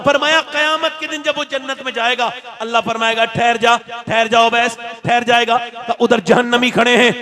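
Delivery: loud, high, impassioned sermon delivery; full-force preaching. Real, not acted. Preacher urging the congregation to honour parents, loud fervent preaching. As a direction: drive every phrase out to the crowd at full volume.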